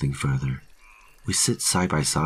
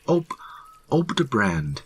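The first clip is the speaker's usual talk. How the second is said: stammered start, then higher-pitched answer; raised voice; 8 semitones higher than usual. A narrator's reading. Terrified hiker repeating his home, raised voice. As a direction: push the place name out, frightened. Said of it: "raising his voice".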